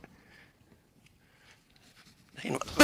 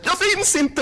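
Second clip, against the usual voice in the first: high, loud panicked chant; the mantra cracking into panic; a frightened high cry of the mantra; 10 semitones higher, 4 dB louder, slower than usual. Real, not acted.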